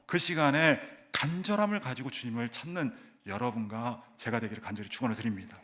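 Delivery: ordinary talk, nothing marked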